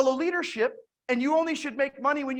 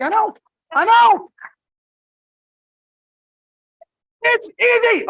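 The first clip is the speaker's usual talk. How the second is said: much higher and louder, delighted brag; excited agreement; 11 semitones higher, 10 dB louder than usual. Retold or imitated. Answering the impressed driver, loud excited glee. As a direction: crow it like it's the easiest win.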